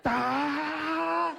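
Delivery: long, high power-up scream; sustained high holler; drawn-out battle yell. Retold or imitated. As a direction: yell it out like charging a final attack. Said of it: "I'm yelling".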